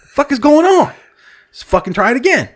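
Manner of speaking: high, exasperated retelling; acted-out bafflement at a stubborn machine; baffled frustration. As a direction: start high and loud, then ease down. Acting out mounting card frustration.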